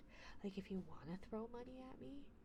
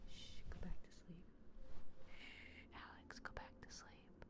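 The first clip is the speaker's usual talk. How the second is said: whispering over a crib. Pretend. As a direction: hush a child back to sleep. Hushing someone back to sleep.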